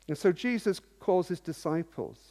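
ordinary talk, nothing marked

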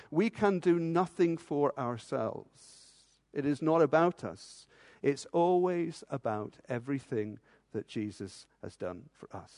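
soft, fading to a whisper-quiet close; hushed conclusion. Acted. Preacher quietly driving the point home. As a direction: let it die away reverently.

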